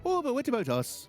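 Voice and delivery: high, plaintive bad Welsh accent; attempted Welsh accent. The Welsh asking to be included, bad Welsh accent. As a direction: Welsh accent slipping into Irish. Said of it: "that sounded more Irish than anything".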